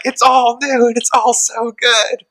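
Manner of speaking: high, drawn-out gushing; gushing delight. Retold or imitated. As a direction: act out being thrilled, slow and high. Acts out own delight at show.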